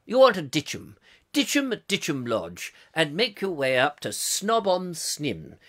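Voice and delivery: put-on posh BBC announcer; plummy BBC accent, drifting into nonsense. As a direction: read grandly in old BBC English. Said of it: "I've got lost in this accent".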